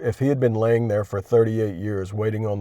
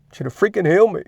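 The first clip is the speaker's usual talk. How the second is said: higher and louder, fed up; exasperated demand; 8 semitones higher, 4 dB louder, faster than usual. Pretend. Demanding healing from Jesus, exasperated. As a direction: demand it through frustration.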